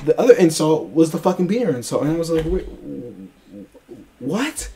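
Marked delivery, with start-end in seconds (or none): 2.5-4.7 stall, then squeak out your bafflement; dumbfounded. Retold or imitated. Retelling his reaction to the insult, dumbfounded. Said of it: "I was so dumbfounded".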